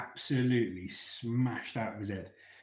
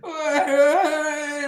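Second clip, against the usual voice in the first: very high, loud wail broken by laughter; mock drunken shout of why, laughing; drunken yell; 11 dB louder than usual. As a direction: howl it out while cracking up.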